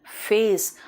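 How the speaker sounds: "फेस" with a true f; corrected "फेस", air streaming on the f; English f. Shown as a demonstration. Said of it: "फेस नहीं".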